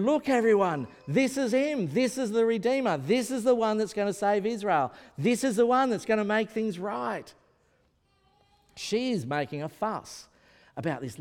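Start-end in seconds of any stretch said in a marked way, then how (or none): 0.0-7.3 raised, eager announcing to the crowd; excited proclaiming. Retold or imitated. Anna calling to everyone in the temple courts, excited and loud. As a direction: proclaim it high and loud like news too good to keep. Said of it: "she's going around saying".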